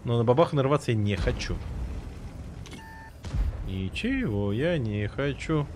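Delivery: usual speaking voice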